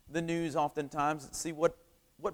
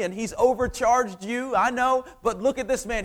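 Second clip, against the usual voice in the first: high, loud public announcement; acted rousing declaration, pitched well up; big proclamation; 7 semitones higher, 6 dB louder than usual. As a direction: proclaim it to the whole crowd. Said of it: "That's a big proclamation".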